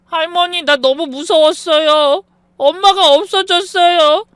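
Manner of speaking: put-on a frightened lost child sobbing to his grandma; crying child. Lost boy runs to his grandmother, high tearful child voice. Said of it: "엉엉 울며".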